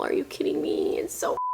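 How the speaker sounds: breathy whisper of delight; whispered disbelief. Real, not acted. Marveling at a bikini shell detail to viewers, whispered disbelief. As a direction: whisper it like you can't believe how cute it is.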